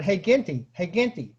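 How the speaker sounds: ordinary talk, nothing marked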